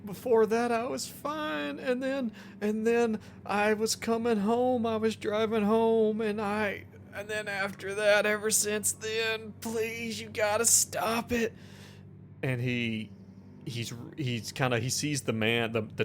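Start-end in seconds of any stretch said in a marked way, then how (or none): 0.0-11.5 pained, tearful patient blurting out his guilt; distraught injured patient. Acted. Billy confesses hitting his father to the doctor, tearful and pained. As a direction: plead through the pain, half crying.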